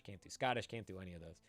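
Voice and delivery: ordinary talk, nothing marked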